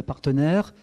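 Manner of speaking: ordinary talk, nothing marked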